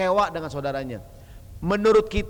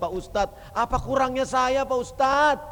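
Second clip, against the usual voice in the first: pleading wife; 8 semitones higher than usual. Retold or imitated. Wife complaining to the preacher.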